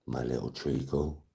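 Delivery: low, soft, slow pet name; playful hushed sweet-talk; mock endearment. Shown as a demonstration. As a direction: drop down and coo it slowly. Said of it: "it would have two different meanings".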